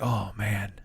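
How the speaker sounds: soft, low "Oh, man." muttered under the breath; quiet groan to himself. Retold or imitated. Stall neighbour, defeated quiet groan. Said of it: "I'm like".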